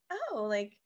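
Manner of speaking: soft, coy little question. Retold or imitated. Asking a stranger about the accent, softly coy. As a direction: ask it shyly, playing innocent. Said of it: "or just like".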